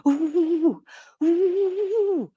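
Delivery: breathy high ooh instead of a whistle; failed whistle. Retold or imitated. Facing the bear without a whistle, failed whistle ooh. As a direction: try to whistle and only blow ooh. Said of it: "I was trying to whistle".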